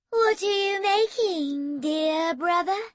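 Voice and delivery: high, slow and sugary sister's voice; sweet cooing. Acted. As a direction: coo it at her brother with false sweetness. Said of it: "in a sweet, cooing voice".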